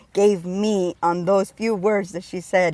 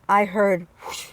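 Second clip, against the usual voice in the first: ordinary talk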